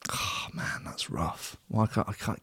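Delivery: whispered, dismayed mutter; muttered aside. Real, not acted. Podcast host finding his sound clip chopped, muttered aside. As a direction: mutter it almost without voice.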